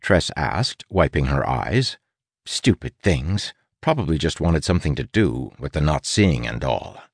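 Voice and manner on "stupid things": ordinary delivery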